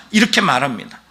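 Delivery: usual speaking voice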